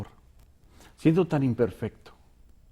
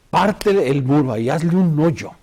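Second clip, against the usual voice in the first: God's command voice; 7 dB louder than usual. Retold or imitated. God orders the prophet.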